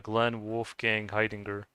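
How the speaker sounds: ordinary talk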